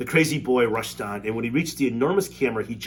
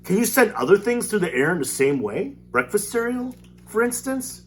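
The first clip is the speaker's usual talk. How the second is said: put-on eager boy; 11 semitones higher, 5 dB louder than usual. Eager boy asking Wonka.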